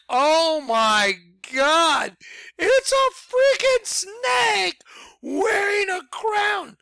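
high, loud, slow shout of discovery; excited shout. Retold or imitated. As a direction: yell it like you just found the secret. Discovering the snake shape.

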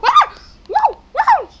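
high, loud screams in short bursts; startled yelps. Real, not acted. Player dodges the killer, startled yelps. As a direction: yelp at each near miss.

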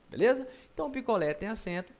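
ordinary talk

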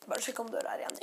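hushed whisper to yourself; quick whispered aside about the door. Real, not acted. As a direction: whisper it so nobody in the house hears. Checks door before screaming part.

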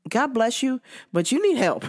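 ordinary talk, nothing marked

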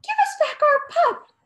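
high, loud demand called after him; shouted. A narrator's reading. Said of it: "the boys shouted".

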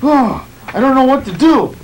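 ordinary talk

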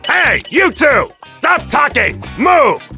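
loud, high, slave-driving shouts; barked angry orders. Acted. Overseer to two chatting workers, angry shouting. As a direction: bark orders at slacking workers.

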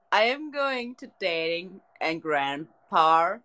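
normal talking voice

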